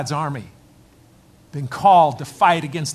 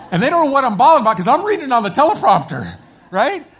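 higher, louder, faster, voice straining; emotional outburst; 6 semitones higher, 7 dB louder than usual. Real, not acted. Pastor recalling crying at the airport, emotional and loud. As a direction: let the feeling burst out of you. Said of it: "and I'm bawling".

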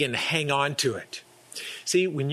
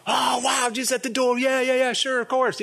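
thrilled exclamation; 8 semitones higher than usual. Pretend. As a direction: gush like Jesus just knocked. Homeowner greets Jesus at door.

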